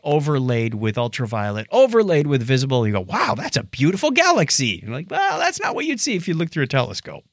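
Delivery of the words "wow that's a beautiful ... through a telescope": ordinary talk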